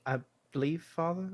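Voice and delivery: put-on a boy asking his father for reassurance; timid son's question; unsure boy.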